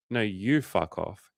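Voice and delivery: slow, drawn-out comeback; unhurried mock retort. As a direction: stretch it out as a deliberate reply. Retold back-and-forth, his turn.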